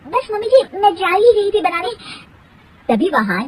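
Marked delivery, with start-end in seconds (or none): 0.1-1.9 high whiny voice with a fake sob; mock whimper. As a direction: whine like a scolded girl. Bahu whining to mother-in-law.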